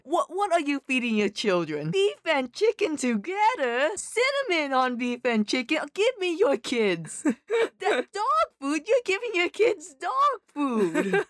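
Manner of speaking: high, slowed, hammed-up disgusted rant; outraged TikTok guy. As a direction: play an appalled parent-judger losing it. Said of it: "that one TikTok of the guys like".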